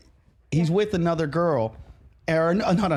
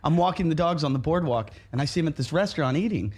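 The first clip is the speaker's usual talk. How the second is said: ordinary talk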